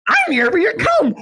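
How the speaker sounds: high squeaky goblin voice. Pretend. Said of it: "the Cum Goblin voice".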